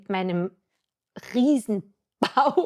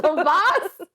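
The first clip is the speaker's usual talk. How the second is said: high, louder, laughing disbelief; shocked laugh; 7 semitones higher, 5 dB louder than usual. Retold or imitated. Zwillinge beim Ultraschall erfahren. (höher und lauter, lachend fassungslos)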